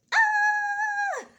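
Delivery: sudden high yelp imitating her jumpy son; a burst of kid energy, high and loud; son's squeal. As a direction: squeal it out like a hyper little boy.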